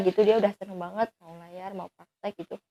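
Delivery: usual speaking voice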